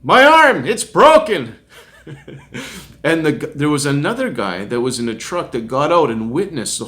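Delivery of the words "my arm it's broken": high, loud cry of pain, acted out; re-enacted scream. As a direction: scream it as if just knocked down, hammed up. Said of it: "I screamed out".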